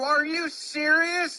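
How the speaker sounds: loud, high, slow outraged yell; furious disbelief. Acted. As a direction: bellow it at the umpire in disbelief. Mock player rage at umpire.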